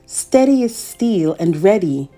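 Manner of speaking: ordinary delivery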